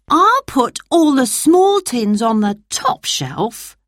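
Dan. Acted. Dan sorting tins to himself, lightly voiced boy. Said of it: "thought Dan".

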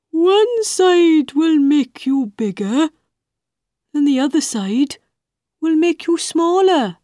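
high caterpillar voice; a knowing bug giving a hint. Acted.

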